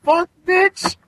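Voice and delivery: sudden high, loud indignant yell; indignant outburst. Retold or imitated. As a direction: shout it back at the accuser, outraged. Retold own outburst at accuser.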